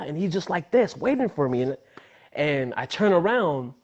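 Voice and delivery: ordinary talk, nothing marked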